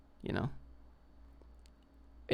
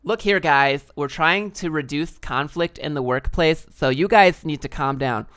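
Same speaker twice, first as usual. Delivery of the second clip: louder, slow, firm HR lady voice; corporate woman; 3 semitones higher, 7 dB louder, slower than usual. Acted. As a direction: scold the team like an HR manager. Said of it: "that corporate woman".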